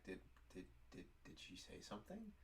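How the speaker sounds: quiet, halting, stammered question; hushed panic. Retold or imitated. Employee wondering if coworker told boss, hushed stammer. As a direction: stammer it under your breath, rising at the end.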